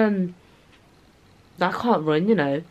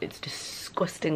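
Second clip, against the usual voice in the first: whisper; 4 semitones lower, 11 dB quieter than usual. Real, not acted. Whispered aside to viewers.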